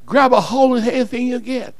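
ordinary talk, nothing marked

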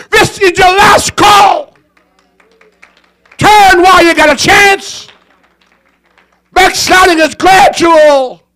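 preaching at full force. Acted. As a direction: plead hard with the congregation. Preaching at full force, altar call.

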